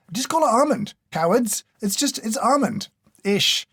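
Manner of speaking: exasperated scolding. Real, not acted. Scolds brands over flavor naming.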